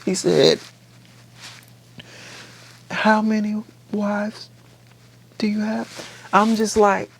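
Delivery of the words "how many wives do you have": mock probing question. Retold suitor asked about wives, high and deliberate. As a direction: draw it out high, pausing midway. Acting out incredulous question in retelling.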